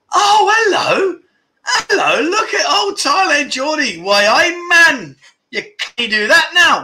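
high, loud, hammed-up Geordie greeting; Geordie accent. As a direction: show off an exaggerated Geordie accent, stretching the vowels. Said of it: "Geordie accent".

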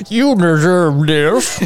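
slow, droopy dog voice; put-on voice of a reproachful hound; talking basset hound.

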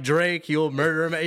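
high, slow mocking line; slowly mock how silly it is; sarcastic. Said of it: "and I'm like".

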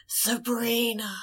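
soft, drawn-out eerie call; scary voice. Retold or imitated. Imitating the monster calling Sabrina from her window, scary voice. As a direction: call the name slowly and quietly, like a lurking monster. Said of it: "there's a scary voice that's like".

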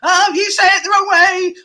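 high, loud mock-prissy correction; hammed-up scolding voice mocking the critics; mocking nagger. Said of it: "they give me enough grief".

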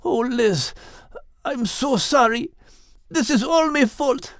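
breaking with grief at the end; anguished. A narrator's reading. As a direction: plead through tears of guilt. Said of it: "Max cried out in anguish".

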